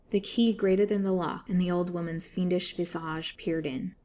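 plain reading voice

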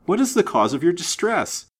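high, urgent, anxious pleading; distressed apology, pitched high; alarmed. A narrator's reading. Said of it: "said he in alarm".

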